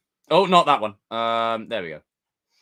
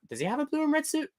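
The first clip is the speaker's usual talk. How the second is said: whiny mock question; faster than usual. Mocking a chatter's question.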